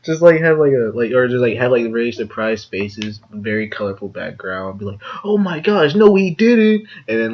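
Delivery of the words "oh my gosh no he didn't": high gasping clickbait shock. Retold or imitated. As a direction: sell the fake shock. Clickbait thumbnail mock.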